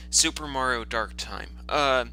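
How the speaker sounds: ordinary delivery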